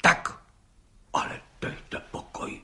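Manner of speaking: stunned judge whispering in amazement; astonished whisper. A narrator's reading. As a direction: whisper it in disbelief. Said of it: "užasl pan soudce".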